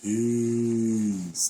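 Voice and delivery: normal talking voice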